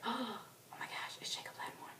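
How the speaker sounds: breathless starstruck whisper; hushed, whispered fan gasp; awestruck whisper. Retold or imitated. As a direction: whisper it as if your idol is right there. Retold awestruck whisper on seeing star.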